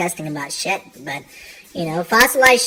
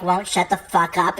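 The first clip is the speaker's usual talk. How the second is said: ordinary delivery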